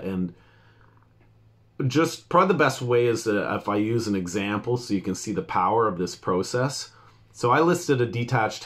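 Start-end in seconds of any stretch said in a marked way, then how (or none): none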